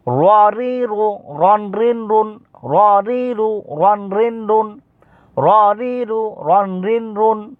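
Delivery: "র রি রু" chanted high with rolled r; high, level recitation of "র রি রু"; ra pronunciation drill. Shown as a demonstration. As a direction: model "রন রিন রুন" for pupils to copy. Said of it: "তারপরে এটা আপনারা দেখেন".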